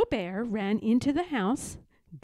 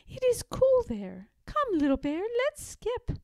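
much higher, bouncy grandmother voice; playful grandmother; 14 semitones higher than usual. A narrator's reading. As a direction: sing it out like skipping.

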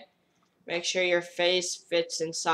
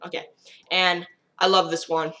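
ordinary talk

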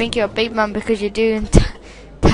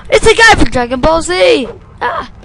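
panicked yell; 3 semitones higher, 6 dB louder than usual. Real, not acted. Panicking at enemy in game.

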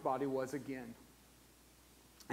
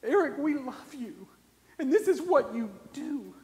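heartfelt comfort; 13 semitones higher than usual. Retold or imitated. Bikers comforting grieving friend.

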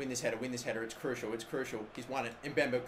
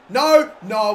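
anguished no; 3 semitones higher, 12 dB louder than usual. Real, not acted. Chance goes wide.

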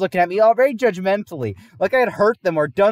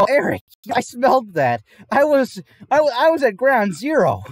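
higher, louder mock-horrified complaint; disgusted friend; 8 semitones higher, 5 dB louder than usual. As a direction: friend still reeling from the stench. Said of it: "And he's like".

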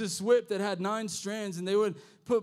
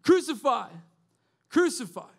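imitated mob yell, louder; crowd demanding; 5 semitones higher, 6 dB louder than usual. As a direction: chant it like a crowd demanding death. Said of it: "are now saying".

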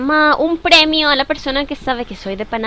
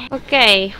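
usual speaking voice

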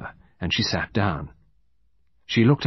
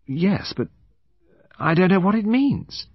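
higher-pitched, hesitant protest; puzzled young woman; 10 semitones higher than usual. Acted. Witness to the detective's odd procedure, puzzled. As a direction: voice a baffled woman, pitched well up.

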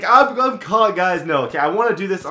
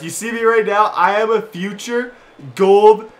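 mock boast; 4 semitones higher, 7 dB louder than usual. Boasting about his own fielding.

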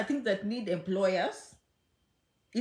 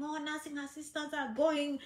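higher, softer mock gossip voice; mocking gossipers; 4 semitones higher, 3 dB quieter than usual. Vlogger mimics people spreading a rumour, mock gossip voice. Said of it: "I've been hearing people saying".

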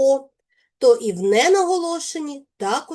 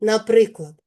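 ordinary talk, nothing marked

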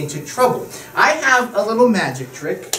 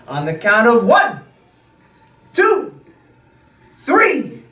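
put-on kids party magician; slower than usual. Magician counting to the trick.

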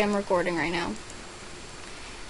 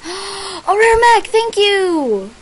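gasp, then high loud slow thanks; overjoyed at a rare item; gasping delight; 10 semitones higher, 9 dB louder, much slower than usual. Real, not acted. Gifted a rare item, gasping delight.

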